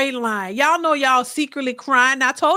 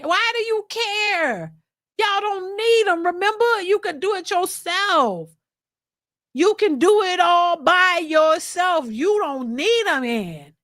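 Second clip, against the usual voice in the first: sarcastic; 5 semitones higher, slower than usual. Real, not acted. Sarcastic encouragement.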